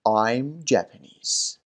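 I'm Japanese, drawn out slowly word by word; slow demo. Shown as a demonstration. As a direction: say it slowly so learners can copy. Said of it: "آوردن کلمهٔ from نداریم". (آهسته و شمرده، نمونه برای تکرار)

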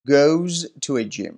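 "goes" drawn out slowly with its z ending; pronunciation demo. Shown as a demonstration. Teacher modelling third-person endings, slow careful "goes". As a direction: say "goes to a gym" slowly, sounding the z in "goes". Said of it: "طریقهٔ تلفظ درستش رو".

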